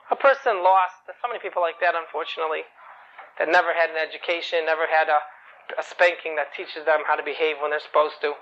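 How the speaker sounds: plain reading voice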